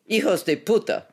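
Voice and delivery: high, loud spat insult; spat Spanish curse. A narrator's reading. Woman caught in a mask spits at captors, furious. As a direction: spit the curse at them. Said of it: "She spat one more Spanish expletive".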